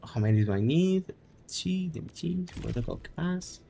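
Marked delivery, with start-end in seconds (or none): none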